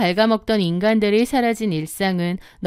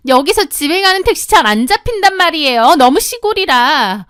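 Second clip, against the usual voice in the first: higher, louder bratty whine; petulant complaint; 6 semitones higher, 5 dB louder than usual. Acted. Sister-in-law whining about no taxis, petulant. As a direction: complain like it is your right.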